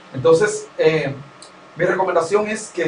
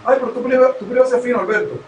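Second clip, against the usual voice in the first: higher, lightly mocking friend; a hint of a friend needling the speaker; mocking a teasing friend; 6 semitones higher, much faster than usual. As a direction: tease him for talking fancy. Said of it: "te diga que tú hablas fino".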